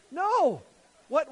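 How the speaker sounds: ordinary talk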